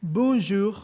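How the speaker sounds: "bonjour" pronounced in French, model for the class. Shown as a demonstration. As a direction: say "bonjour" the French way, nasal bon, throat R.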